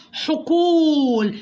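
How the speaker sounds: "حقول" with the waw held long; drawn-out long vowel. Shown as a demonstration. Teacher models the word for spelling, long drawn-out "حقول". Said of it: "أدي الحرف حقه في النطق".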